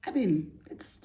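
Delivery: breathy whispered aside. Real, not acted. Whispering an aside to viewers. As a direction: drop to a quick whisper.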